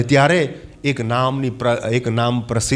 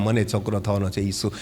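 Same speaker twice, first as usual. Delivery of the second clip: much softer, a private murmur to herself; Mary's quiet musing; 5 semitones lower, 6 dB quieter than usual. Pretend. Young Mary murmuring to herself about her coming son, much softer. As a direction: murmur it like a thought you can't stop.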